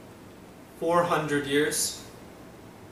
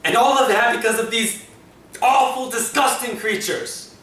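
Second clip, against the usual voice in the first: higher, rising outburst of disgust; anguished anger, voice raised; angry disgust; 5 semitones higher than usual. Acted. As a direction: spit out your loathing of these creatures. Monologue of anguish about Daleks.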